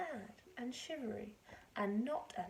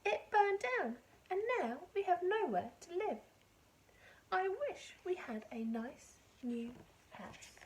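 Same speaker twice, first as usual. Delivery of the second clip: mouse voice; 8 semitones higher than usual. Acted. Mouse mother continues.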